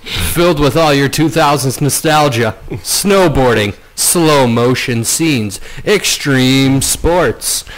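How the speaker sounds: slow, drawn-out radio host listing; hammy movie-trailer pacing. Acted. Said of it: "whole podcast in radio voice".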